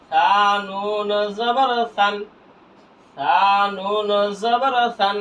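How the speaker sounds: slow, long-held syllables; measured spelling drill for learners; drawn-out spelling. Shown as a demonstration. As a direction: recite each letter name stretched out. Spelling drill recited twice.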